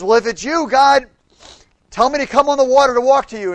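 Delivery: loud, high, eager call across the water; Peter calling out. Acted. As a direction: shout it out to Jesus on the waves.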